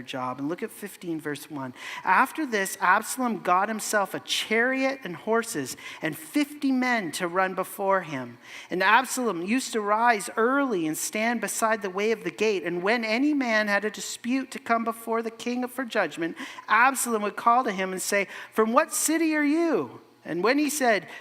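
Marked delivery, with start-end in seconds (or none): 2.0-18.3 raised, measured Bible reading voice; scripture reading. Acted. Reads scripture aloud. 18.5-20.0 lightly voiced eager greeter, pitched higher; a hint of a flattering prince calling out; Absalom greeting. 20.2-21.1 raised, measured Bible reading voice; scripture reading. Acted. Reads scripture aloud.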